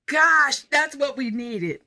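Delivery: retold awe, punched out high then slowing; impressed exclamation. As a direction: act out an amazed, emphatic reaction. Retold reaction of police and ushers.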